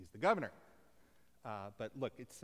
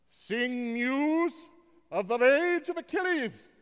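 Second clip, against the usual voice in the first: high, booming declamation; theatrical actor; 12 semitones higher, 12 dB louder, slower than usual. Acted. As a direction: declaim it grandly to the back row. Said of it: "why not be an actor?".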